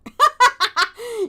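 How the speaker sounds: high, loud burst of laughter. Real, not acted. Reader amused by wish to go home, laughing. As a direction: burst out laughing mid-reading.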